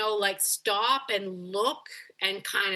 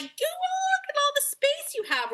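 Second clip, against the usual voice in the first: very high sing-song urging to a dog; gushing dog talk. Retold or imitated. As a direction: send the dog off in a squeaky excited voice. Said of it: "we're like".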